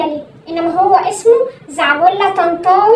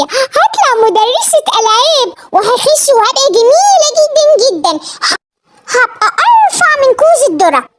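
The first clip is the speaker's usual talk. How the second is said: dreamy giddy excitement; 6 semitones higher, slower than usual. Acted. Daughter dreaming of being slim.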